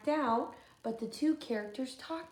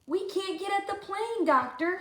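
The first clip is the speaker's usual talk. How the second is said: worried Luther; 9 semitones higher than usual. Acted. Luther reporting.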